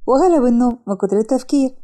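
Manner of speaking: usual speaking voice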